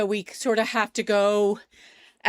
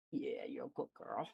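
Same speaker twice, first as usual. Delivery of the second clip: soft pet talk; 9 semitones lower, 17 dB quieter than usual. Real, not acted. Cooing to her dog.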